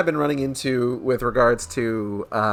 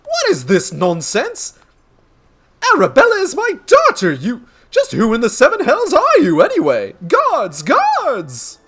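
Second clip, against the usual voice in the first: high, loud noble in uproar; theatrical sputtering outrage; outraged father; 13 semitones higher, 6 dB louder than usual. Acted. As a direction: bellow indignantly and summon the guards. Outraged father calls guards.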